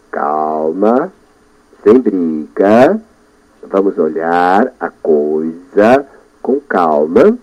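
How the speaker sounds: slow, low, soothing voice to himself; calm yourself down, very slowly; soothing self-talk. Shown as a demonstration. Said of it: "Eu falo assim comigo". (falando baixo e devagar consigo mesmo)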